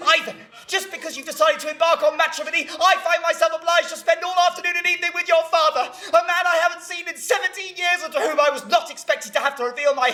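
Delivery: outraged tirade. Acted. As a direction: rant on, mounting to the last words.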